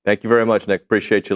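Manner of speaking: ordinary delivery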